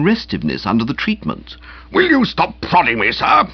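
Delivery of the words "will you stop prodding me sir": put-on higher and sharper, an old man's indignant outburst; irritated cry. A narrator's reading. Uncle Percy to Boco, who keeps prodding him, indignant cry. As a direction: snap at the man poking your chest. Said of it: "he cried, plainly stirred".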